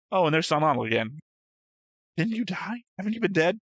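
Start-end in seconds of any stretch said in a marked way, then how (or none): none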